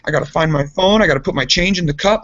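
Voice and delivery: high-pitched imitation of defensive listing; mock defensive excuses. Adam defending sitting at the pump, mock defensiveness.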